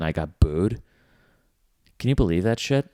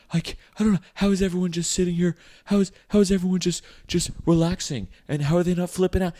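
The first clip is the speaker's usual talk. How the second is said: high, fast panicky whine; whiny complaining; 12 semitones higher, 3 dB louder, faster than usual. Retold or imitated. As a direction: whine like the spice is killing you. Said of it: "bitching the whole time".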